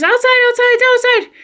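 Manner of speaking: high-pitched frantic command, acted out; urgent retold cry. Owner running downstairs to the sick dog, frantic high cry.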